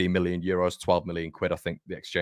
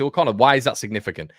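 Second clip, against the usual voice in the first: mock doubting viewer; 4 semitones higher, 6 dB louder, faster than usual. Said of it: "a lot of you guys might say".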